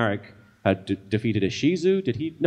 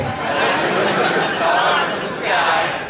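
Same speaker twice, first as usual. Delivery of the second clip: high, slow echo of the oath; crowd echo; 9 semitones higher, slower than usual. Shown as a demonstration. Crowd echoing the evil pledge, slow and much higher. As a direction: repeat it slowly like a giggling cult crowd. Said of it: "recite the Pledge of Evil Allegiance".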